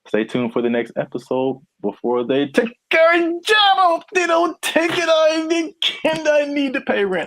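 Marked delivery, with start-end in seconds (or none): 1.8-7.3 very high-pitched comic yell; mock outraged yelling. Host begs listeners before jobs get taken, mock outraged yelling. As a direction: yell it in a high, hammed-up protest.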